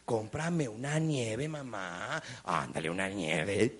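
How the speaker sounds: low, soft, drawn-out whining; pleading little boy. Retold or imitated. Boy begs his mom for ice cream, low wheedling. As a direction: drag out every word, begging quietly. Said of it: "va con su madre y le dice".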